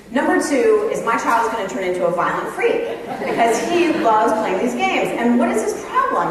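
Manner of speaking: acted fretting rant, pitch leaping on the questions; exasperated parent. Parent fretting over son's video games, acted exasperation. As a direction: pile up indignant questions, hammed-up. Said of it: "you are thinking to yourself".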